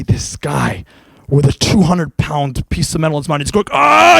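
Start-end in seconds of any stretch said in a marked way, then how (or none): 3.7-4.2 loud high strained yell of effort; weightlifter's pained roar; straining scream. Retold or imitated. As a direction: scream like lifting a huge weight. Weightlifter straining with heavy metal.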